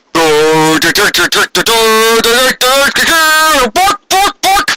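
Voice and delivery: high mock bork barks; dog barks. Brother replacement introduced as a dog, high mock barks. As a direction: bark like a silly dog.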